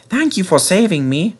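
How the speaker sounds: ordinary delivery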